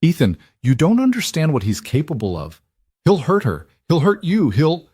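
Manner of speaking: panicked. A narrator's reading. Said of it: "her eyes wild".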